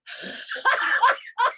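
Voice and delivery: high staccato cackle exhales; laughter breath. Shown as a demonstration. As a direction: push out staccato laughs until empty. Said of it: "And I'll do it again".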